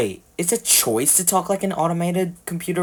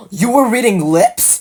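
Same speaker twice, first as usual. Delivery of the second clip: high, louder realization; surprised; 8 semitones higher, 6 dB louder than usual. Real, not acted. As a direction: blurt it out in disbelief. Realizes a plot hole.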